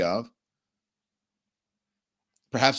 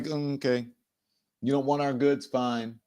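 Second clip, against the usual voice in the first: acted unbothered; 4 dB quieter than usual. Host voicing China's reply to pressure, acted unbothered. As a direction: answer as if it hardly matters. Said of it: "They are unbothered".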